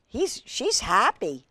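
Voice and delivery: ordinary delivery, nothing marked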